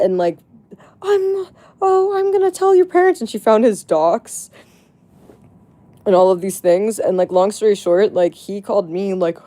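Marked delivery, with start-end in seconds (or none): 1.0-3.2 high, fast, whiny fake-crying threat; mock hysterical threat. Mimicking the friend threatening to tell the guy's parents, high hammed-up crying. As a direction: ham up a tearful tantrum. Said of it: "blowing up".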